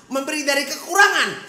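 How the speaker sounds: loud, high emphatic preaching; preaching loudly. Acted. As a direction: drive the point home with force. Preacher contrasts giving in sermon.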